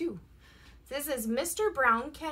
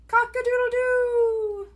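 rooster crow; 8 semitones higher, 3 dB louder than usual. A narrator's reading.

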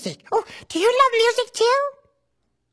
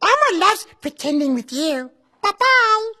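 Elmo voice. Acted. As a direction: speak as cheerful Elmo to a child. Elmo's voice throughout.

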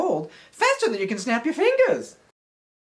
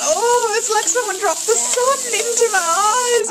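high-pitched comic wail of agony; pained wailing; 12 semitones higher, 3 dB louder than usual. Acted. Little man splashed in the eyes, hammed-up wailing. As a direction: cry out as if your eyes sting.

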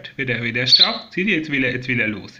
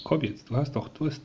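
usual speaking voice

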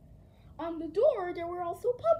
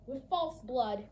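ordinary delivery

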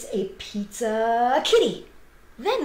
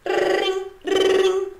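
doorbell ring; 10 semitones higher, 5 dB louder than usual. Pretend. Doorbell imitation.

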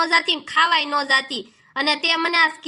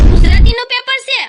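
shocked shout, much higher and louder; shocked cry; 6 semitones higher, 11 dB louder than usual. Acted. Dhokli learns the exam is Gujarati, shocked shout. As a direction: blurt it out in sudden panic.

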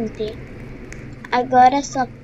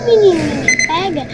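normal talking voice

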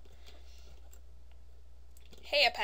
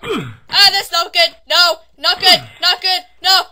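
loud, higher panicked shouts; panicked yelling; 5 semitones higher, 19 dB louder than usual. Real, not acted. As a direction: yell in alarm as it goes wrong. Panics during play.

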